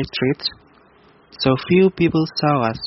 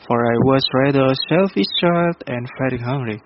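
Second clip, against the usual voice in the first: ordinary delivery, nothing marked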